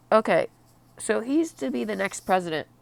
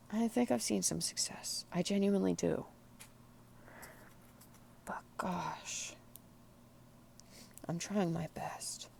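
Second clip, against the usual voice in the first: tearful whisper, barely voiced; 14 dB quieter than usual. Real, not acted. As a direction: whisper it, holding back tears. Emotional confession, voice fading.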